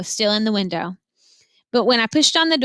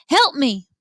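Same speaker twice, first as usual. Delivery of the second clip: higher, louder cry for help; shouted plea; 4 semitones higher, 4 dB louder than usual. A narrator's reading. Otis shouting to the girl for help amid chaos. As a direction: call out in a panic. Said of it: "And Otis shouted".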